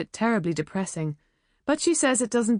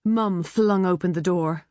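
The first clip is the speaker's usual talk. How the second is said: usual speaking voice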